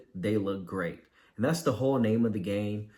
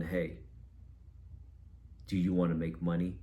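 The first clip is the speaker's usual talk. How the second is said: flat pitch; 3 semitones lower, 7 dB quieter than usual. Shown as a demonstration. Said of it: "better than".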